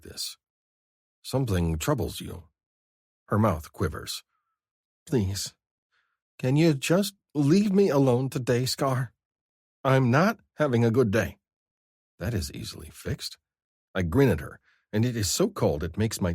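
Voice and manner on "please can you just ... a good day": higher, pleading woman's voice near tears; Vivi's voice. A narrator's reading. Vivi begging Scar to leave her alone, pleading. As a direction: beg to be left alone, voice raised high.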